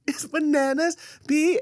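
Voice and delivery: put-on high-pitched girl quoting; squeaky imitation of a girl; high girly voice. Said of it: "And she was like".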